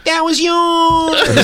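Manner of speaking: stretched slow high falsetto, laughing; high giggly falsetto tease; mocking high squeaky voice. Mocking the girl's line in a slow high falsetto.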